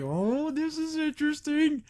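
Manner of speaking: high, drawn-out intrigued coo; mock intrigue. Viewer reacting to the man's "let me see", mock intrigue. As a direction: stretch it out high, hammed-up intrigue.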